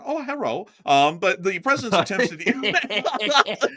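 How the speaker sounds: high, drawn-out pleased oh hello; delighted surprise. Real, not acted. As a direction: caught off guard by something tasty. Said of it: "That's what he does when he sees the Twinkie truck".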